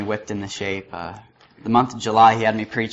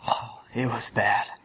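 slow, whispered through a chuckle; soft amused whisper; laughing whisper; 6 dB quieter than usual. Real, not acted. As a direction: breathe it out with a rueful laugh. Recalling agony of keeping secret.

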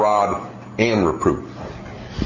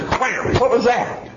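put-on much higher surprised child voice; startled kid; 13 semitones higher, slower than usual. Child smacked out of nowhere, mock startled. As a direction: yelp like a kid just smacked.

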